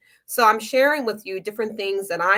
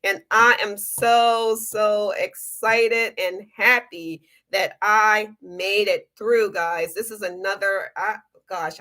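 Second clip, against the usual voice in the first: ordinary delivery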